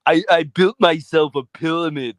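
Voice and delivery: put-on thick Austrian strongman accent; mock action-hero impression; Arnie. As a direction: protest like an Austrian action star. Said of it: "can you do the audio impression?".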